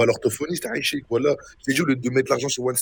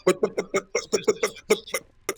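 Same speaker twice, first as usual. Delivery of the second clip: higher, laughing bot bot bot like a clucking hen; mocking chicken cluck; 7 semitones higher, faster than usual. Mocking a stuttering player with higher, laughing chicken clucks. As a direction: cluck at him like a chicken to mock his stutter. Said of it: "Arrête de faire la poule".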